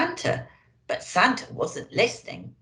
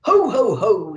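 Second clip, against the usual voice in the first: belly-laugh it like Santa; hearty Santa laugh on the sleigh; 3 semitones higher, 7 dB louder than usual. Acted. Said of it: "laughed Santa".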